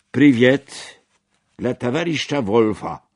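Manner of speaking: loud opening greeting to the hall; acted public cheer, strongest on the first word; delegate calling out. A narrator's reading. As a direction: call out a salute to a comrade. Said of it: "zawołał robotniczy delegat".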